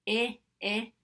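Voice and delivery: I, I as short ih sounds like igloo; two quick ih sounds. Shown as a demonstration. Teacher leading the vowel chant, short i. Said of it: "as I say the sound".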